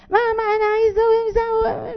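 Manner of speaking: put-on high whining child asking mama; a child nagging mom, high and whiny. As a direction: whine like a small child wanting something. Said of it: "جه الطفل يقول".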